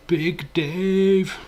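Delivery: high, loud put-on persona voice; alter ego voice. Streamer announcing his alter ego to chat, high and loud. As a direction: boom out the name as a hammed-up alter ego. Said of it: "my alter ego".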